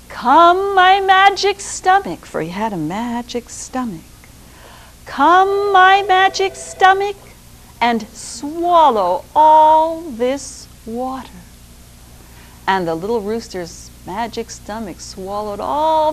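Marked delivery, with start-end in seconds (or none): 0.1-2.2 high, loud call to his stomach; rooster calling. Acted. Rooster in the well calling his magic stomach, high and loud. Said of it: "he called out". 5.0-11.3 high, loud call to his stomach; rooster calling. Acted. Rooster in the well calling his magic stomach, high and loud.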